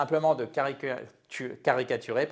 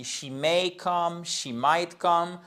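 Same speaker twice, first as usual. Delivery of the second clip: she may come, she might come said slowly and higher; slow model pair; 4 semitones higher, slower than usual. Shown as a demonstration. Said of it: "c'est un M aussi". (lent et plus aigu, pour comparer)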